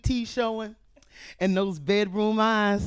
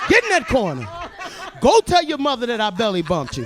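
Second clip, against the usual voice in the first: stern teacher yell; 5 dB louder than usual. Pretend. Teacher yelling at kid.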